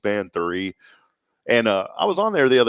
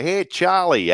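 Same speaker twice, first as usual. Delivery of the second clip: put-on higher-pitched Northeastern accent call; playful put-on up-north accent; 8 semitones higher, 3 dB louder, much slower than usual. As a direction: imitate a New England greeting. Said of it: "they don't have that, like".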